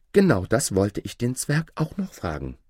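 ordinary delivery, nothing marked

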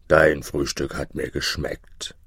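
low, satisfied giant voice. A narrator's reading. Giant to the shepherd after breakfast, low giant voice. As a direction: speak low as a well-fed giant.

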